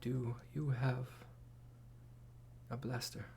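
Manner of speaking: faint, fading breaths of a dying woman; weak dying voice. Acted. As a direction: whisper it like your last words.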